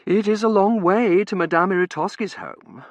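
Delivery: emphatic but composed protest. A narrator's reading. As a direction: object with polite insistence. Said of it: "Cousin Verity protested".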